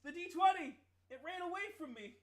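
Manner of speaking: high, loud panicked yelp; alarmed cry. Real, not acted. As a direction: yelp as the die rolls away. Dice fell off the table.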